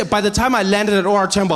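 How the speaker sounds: usual speaking voice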